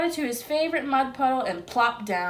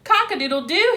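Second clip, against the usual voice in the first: snickering crow; 8 semitones higher, 7 dB louder, faster than usual. A narrator's reading. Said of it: "he snickered".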